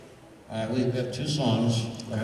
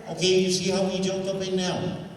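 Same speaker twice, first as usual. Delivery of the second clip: ordinary delivery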